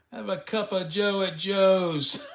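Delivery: slow high jingle read; ad slogan voice. Pretend. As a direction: sell it like a radio ad. Mock slogan for a coffee shop.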